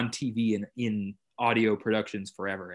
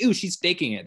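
mocking listener; 9 semitones higher, 4 dB louder than usual. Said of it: "they're not gonna be like".

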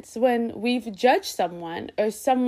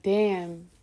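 mock discouraged; 4 semitones lower, 8 dB quieter than usual. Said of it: "sit there and be like".

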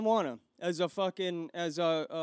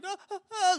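put-on lost little kid whimper; 11 semitones higher than usual. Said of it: "like a little kid".

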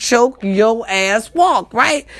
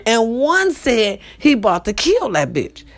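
normal talking voice

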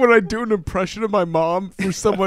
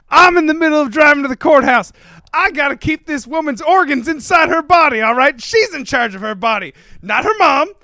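higher, louder courtroom lawyer speech; movie lawyer; 7 semitones higher, 5 dB louder than usual. Acted. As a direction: argue the case like a film attorney. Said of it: "Are you doing my sister's keeper?".

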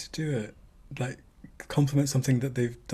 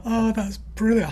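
delighted; 10 semitones higher, 4 dB louder than usual. Real, not acted. Delighted by the joke.

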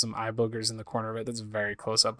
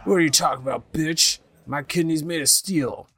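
put-on angry drunk; 5 semitones higher, 4 dB louder than usual. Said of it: "super angry face".

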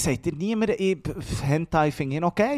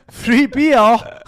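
"Free Bier" lachend laut mit f; "Free" mit f lachend nachgeäfft; laughing mock th as f; 5 semitones higher, 10 dB louder than usual. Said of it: "nur noch F sagen". (lachend "Free Bier" mit f)

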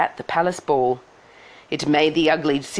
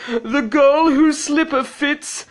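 loud, high, slow proclamation; grand, drawn-out royal announcement; prince crying out; 10 semitones higher, 5 dB louder, slower than usual. A narrator's reading. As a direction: declaim it like a pompous prince. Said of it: "he cried".

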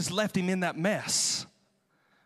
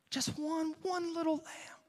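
higher and softer, tender; soft, higher, pitying; 7 semitones higher, 7 dB quieter than usual. Acted. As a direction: make them feel for the lamb. Pathos over the poor man's lamb.

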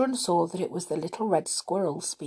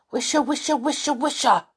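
higher, insistent loud tree rustle; loud rustling trees; 8 semitones higher, 4 dB louder, faster than usual. A narrator's reading. Trees urge the children to listen, loudly. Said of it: "said the trees loudly".

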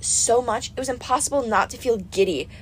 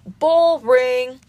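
bored goddess, louder; Aphrodite; 3 semitones higher, 5 dB louder than usual. A narrator's reading. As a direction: drag the word out in boredom. Aphrodite dismisses the hunters.

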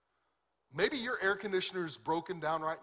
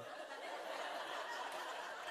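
real laugh; 12 dB quieter than usual. Real, not acted. Laughing at wife's stink eye.

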